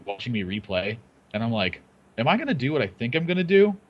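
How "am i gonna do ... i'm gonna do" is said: higher, questioning self-quote; wondering inner thought. Retold or imitated. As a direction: voice your own puzzled thought aloud. Quotes own thought while rewatching.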